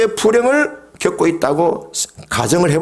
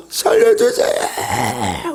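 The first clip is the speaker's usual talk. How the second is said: slow, stretched crying plea; a last stretched cry for rescue; drawn-out wail; 2 semitones lower, much slower than usual. Acted. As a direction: draw it out into a wail. End of the acted prayer.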